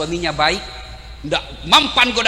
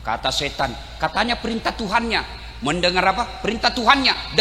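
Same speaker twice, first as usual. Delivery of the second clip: usual speaking voice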